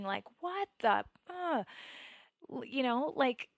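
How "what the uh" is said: high, loud, cut-off outburst; mock scream that breaks off in exasperation; retold angry scream. Meditator screaming at God in her mind, exasperated. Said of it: "I was screaming".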